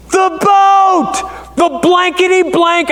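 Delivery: high, shouted alarm, the boat is loose; uncle's shout. Retold or imitated. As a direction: yell out that the boat is drifting. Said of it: "he said".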